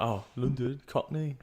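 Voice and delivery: attempted Cockney accent. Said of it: "I can't do a Cockney accent".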